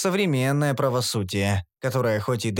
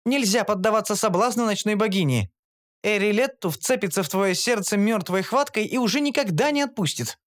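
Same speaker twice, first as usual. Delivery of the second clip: higher, earnest warning voice; warning Shantri; 7 semitones higher than usual. A narrator's reading. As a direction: warn him gravely. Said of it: "Шантри". (Шантри предостерегает высоким голосом)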